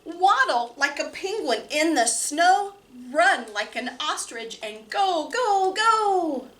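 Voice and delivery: lively picture-book reading with big pitch swings; reading to children. Acted. Librarian reading Hooray for Birds to children, lively and playful. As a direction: read it bouncy and playful for small kids.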